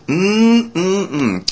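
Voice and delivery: high, hammed-up yummy "mm-mm-mm"; savouring hum. Receptionist relishing a pretend bowl of pudding, savouring hum. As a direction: hum in exaggerated delight at the food.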